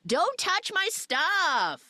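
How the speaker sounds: high, drawn-out mock yell; stretched, high-pitched scolding call-out; retold yell from offstage. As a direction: imitate yelling from out of sight, stretching the words. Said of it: "hear Ryan Reynolds out of sight yelling".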